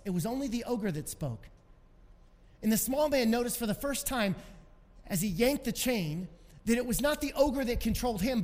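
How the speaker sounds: ordinary talk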